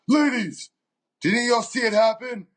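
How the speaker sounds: high, projected call to a crowd; mock calling out. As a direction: imitate a bystander taking charge of onlookers. Retold man calling out to crowd.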